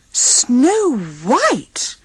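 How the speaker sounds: high, amazed question; wide-eyed wonder; amazed echo. Acted. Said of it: "the dwarfs echoed, wide-eyed".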